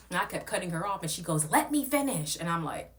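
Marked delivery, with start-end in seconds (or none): 1.5-2.4 high, loud mock snap; high loud mock demand from the host; mock snapping host.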